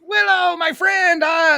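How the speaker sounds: put-on high, slow, shouted hello to an old friend; excited reunion greeting. Brownie guys greeting Willow again, high slow shout. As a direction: call out like greeting a long-lost buddy. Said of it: "they're like".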